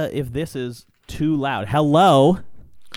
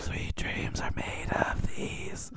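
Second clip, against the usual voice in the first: slow breathy ASMR whisper; 8 dB quieter, much slower than usual. Pretend. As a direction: whisper the lyric slowly into the mic. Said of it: "This is an A S M R podcast now".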